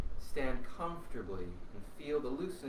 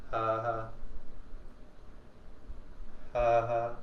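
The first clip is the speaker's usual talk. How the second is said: voiced double "ha" on the out-breath; breath turned to sound; 2 semitones lower than usual. Shown as a demonstration. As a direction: release two relaxed warm-up pulses of sound. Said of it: "to turn to sound".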